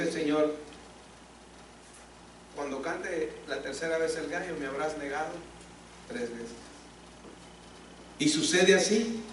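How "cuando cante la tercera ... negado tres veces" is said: solemn prophecy. Retold or imitated. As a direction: speak it softly as a grave warning. Jesus foretells the denial. (Jesús advierte a Pedro en voz muy baja y grave)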